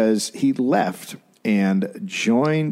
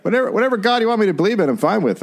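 mock eager convert; 10 semitones higher, much faster than usual. Joining the forced-convert bit.

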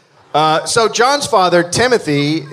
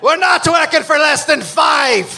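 demanding worker shout; 9 semitones higher than usual. Acted. Voicing striking dockworker.

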